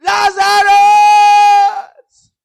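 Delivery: very high, long-drawn shout; rich man's shout. Acted. Rich man in hell calls up to Lazarus, long pleading shout. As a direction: call out desperately across a great distance. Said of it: "He shouted".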